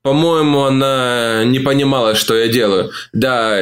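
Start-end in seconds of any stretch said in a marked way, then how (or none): none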